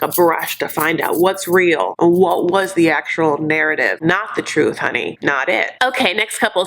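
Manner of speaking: normal talking voice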